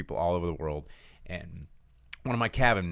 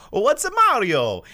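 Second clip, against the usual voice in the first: put-on high, loud Italian accent; Mario voice; 11 dB louder than usual. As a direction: innocent Italian-accented question. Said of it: "You realize you sound like Mario?".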